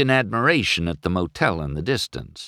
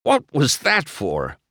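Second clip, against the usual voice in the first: higher-pitched indignant question; mildly affronted protest; demanding; 7 semitones higher than usual. A narrator's reading. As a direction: demand an explanation. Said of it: "McQueen demanded".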